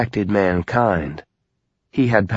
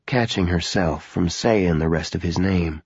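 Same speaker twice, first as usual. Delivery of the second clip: ordinary delivery, nothing marked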